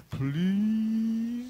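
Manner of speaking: high, drawn-out whiny please; child pleading with a parent; kid begging. Retold or imitated. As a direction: whine it like a kid. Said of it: "any kids tell you".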